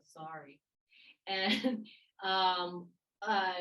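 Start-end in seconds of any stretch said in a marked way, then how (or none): 0.0-0.6 mumbled, unwilling apology; a sulky kid forced to apologize; grudging sorry. Retold or imitated. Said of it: "They always go".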